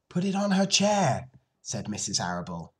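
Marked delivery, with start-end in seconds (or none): 0.1-1.3 higher-pitched instruction; lightly voiced mother, pitched up; mother directing. Mother tells father where the carton goes, higher voice.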